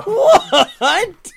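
high, loud laughing shout; shocked. Real, not acted. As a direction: blurt it out in disbelief.

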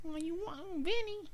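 very high, soft mock New York woman; high-pitched New York movie-girl accent; New York accent impression. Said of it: "that New York accent".

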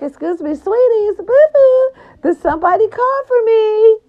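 high, loud sing-song diva greeting; diva entrance. Acted. Woman bopping in the door to family, high loud diva voice. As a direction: sweep in like a diva making an entrance.

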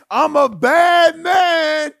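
high, slow, loud shouted swagger; loud, slow, proud bragging shout; chest-puffing boast. Acted. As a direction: strut it out slowly. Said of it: "He's puffing his chest up".

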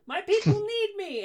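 very high dramatic creature voice; high heroic farewell; tabaxi character voice. Acted. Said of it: "tabaxi character line".

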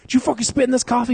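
high, fast, loud comic rant; mock indignant demand; Chris Rock. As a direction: imitate an irate comedian, fast and high. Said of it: "that reminds me of Chris Rock".

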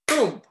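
a sudden loud magic boom. A narrator's reading. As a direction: punch it out like a blast. Magic transformation sound.

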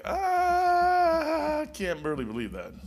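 high, slow disbelieving voice; drawn-out doubtful reply; high and slow in disbelief; incredulous young self. Retold or imitated. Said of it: "I'm like".

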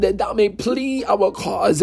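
usual speaking voice

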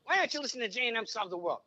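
high, fast pushy gangster voice; mock Pesci ad for J and M Solve the World; Pesci-style podcast plug. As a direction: hype the show like a wound-up hothead. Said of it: "Funny how?".

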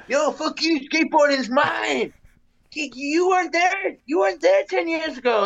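high, mock shouted gatekeeping; mocking territorial skater. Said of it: "So it's like".

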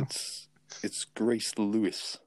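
breaking up on a bad call line; choppy, dropping connection; cutting out. Real, not acted. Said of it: "You're cutting out".

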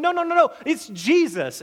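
correcting a scoffer with rising insistence; insistent protest. Retold or imitated. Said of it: "we're going".

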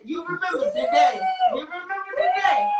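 hammed-up tearful yell; mock pleading cry. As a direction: wail it like a hurt friend. Pleading reminder to friend.

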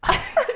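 sudden snort of laughter. Real, not acted. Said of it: "did you just snort?".

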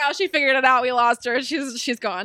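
loud mock lament; hammed-up dad despair. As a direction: wail like a defeated dad. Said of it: "he's like".

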